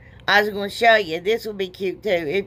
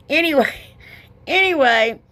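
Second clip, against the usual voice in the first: laughing it off; 7 semitones higher, 3 dB louder than usual. Real, not acted. Embarrassed at rambling.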